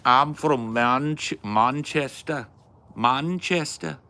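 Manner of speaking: high, slow, drawn-out Mancunian; mock Manchester accent. Trying out British regional accents, mock Manchester accent.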